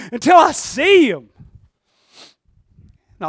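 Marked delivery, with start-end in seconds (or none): 0.1-1.3 high, drawn-out loud insistence; impassioned cry. Acted. Mary insists she must see Jesus, impassioned high cry. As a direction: call it out slowly with full conviction. Acted desperate reply of Mary.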